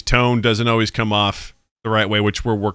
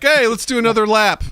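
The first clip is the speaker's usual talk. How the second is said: bright, much higher, breezy change of subject; cheery deflection; 12 semitones higher, 3 dB louder than usual. Retold or imitated. Dad breezily steering daughter to swim. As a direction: steer her off the topic brightly.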